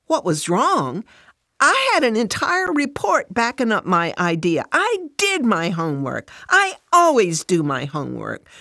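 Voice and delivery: worked-up, defensive protest; indignant. Acted. Fran defending her homework to Jesus, indignant. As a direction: protest hotly, leaning hard on key words.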